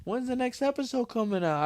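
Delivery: high, bright fan question; imitating middle-aged woman. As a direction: ask it like an eager fan. Imitating middle-aged women asking.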